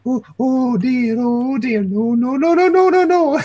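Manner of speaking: put-on an old woman backing away in a fluster; flustered old lady. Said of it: "She's gonna try and do something like that".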